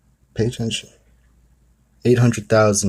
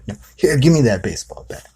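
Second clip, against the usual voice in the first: impatient dad, higher and louder; scolding dad; 5 semitones higher, 4 dB louder than usual. Retold or imitated. Dad scolding son, taking the bat, higher and louder. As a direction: grab the bat and lecture him. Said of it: "He's basically scolding the kid".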